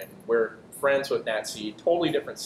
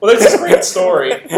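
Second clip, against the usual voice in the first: high, loud laughing sarcasm; mock sarcastic laugh; 11 semitones higher, 15 dB louder than usual. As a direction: tease with a laughing fake praise.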